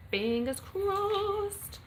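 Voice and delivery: very slow, high, stretched good-luck wish; drawn-out sing-song. Pretend. Streamer wishing Millie luck in the competition, high drawn-out sing-song.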